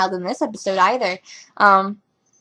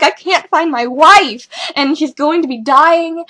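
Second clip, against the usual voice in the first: breaking down; 6 semitones higher, 7 dB louder, slower than usual. Retold or imitated. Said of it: "wants to break down and be like".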